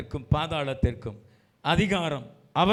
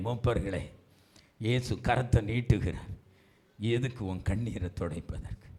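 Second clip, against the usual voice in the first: low, soft pastoral address; gently reach out, sinking softer; tender appeal; 6 semitones lower, 6 dB quieter than usual. Real, not acted. Preacher addresses brothers, sisters, elders, tender appeal.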